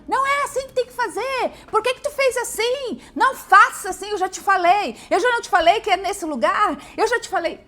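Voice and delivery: high, loud exasperated scolding; mother scolding about chores, loud and high; nagging scold. Retold or imitated. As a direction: scold them for doing it wrong. Scolding for wrong way.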